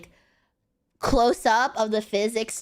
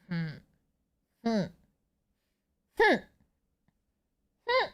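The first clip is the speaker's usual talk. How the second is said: mock angry; 4 dB quieter, faster than usual. Shown as a demonstration.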